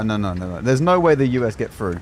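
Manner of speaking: usual speaking voice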